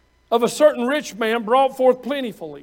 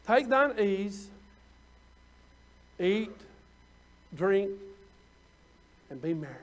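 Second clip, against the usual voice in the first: lazy ease; 3 semitones lower, 6 dB quieter than usual. Retold or imitated. Reading the rich man's words.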